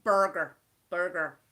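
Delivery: burger with a full American R; American accent. Acted. Relishing how Americans say burger, American accent. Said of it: "the way you all say burger".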